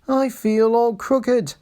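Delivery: high, slow complaining engine; broken-down engine complaining. A narrator's reading. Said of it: "he complained".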